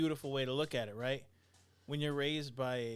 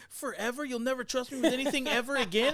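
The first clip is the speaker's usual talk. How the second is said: high, loud, whiny disbelief; hammed-up outraged son; mock teen outrage; 8 semitones higher, 7 dB louder than usual. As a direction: play the wounded teenager overreacting. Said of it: "And he's like".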